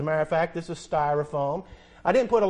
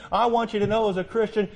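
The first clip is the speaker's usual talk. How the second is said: ordinary delivery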